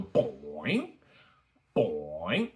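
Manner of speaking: springy, drawn-out dip-and-rise BOING; third tone. Shown as a demonstration. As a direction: bounce BOING down and back up. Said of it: "kind of like Tigger".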